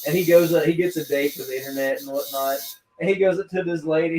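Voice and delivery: ordinary delivery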